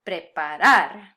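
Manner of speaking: preparar with a long, loud "RAR"; slow preparar, weight on the last syllable; last-syllable stress. Shown as a demonstration. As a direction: hit the final "ar" of preparar. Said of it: "fall on the last syllable".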